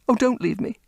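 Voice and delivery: high, pleading; beg, near tears; desperate plea. Acted. Repeated plea, rising despair.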